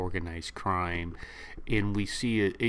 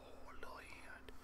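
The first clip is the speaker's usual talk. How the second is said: a hushed, breathy whisper of delight; soft awed whisper at the tiny hand; 22 dB quieter than usual. Retold or imitated. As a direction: whisper it like it's too cute to say aloud. Whispered echo of the narration.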